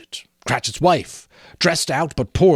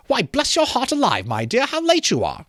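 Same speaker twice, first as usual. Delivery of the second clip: much higher, bustling mother's voice; fretful mother; 10 semitones higher than usual. Acted. Mrs Cratchit asks her children where father is, higher and fussing. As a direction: fuss like a busy mother waiting for her family.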